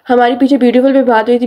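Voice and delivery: usual speaking voice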